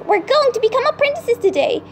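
high-pitched eager announcement; excited mew. A narrator's reading.

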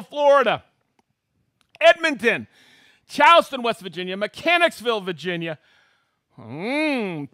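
loud, high announcer shout-outs; excited host roll call. Acted. Host hailing viewers watching from around the world, excited roll call. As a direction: call out each place like a rowdy emcee.

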